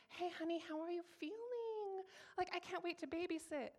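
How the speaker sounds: high sing-song fake-friendly coo; mock sweetness. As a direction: gush insincerely, stretching the question. Mocks suddenly friendly people.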